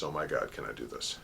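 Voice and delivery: a hushed, shrinking repeat of can I do this; fading anxious murmur. Retold or imitated. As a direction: let the doubt sink into a murmur.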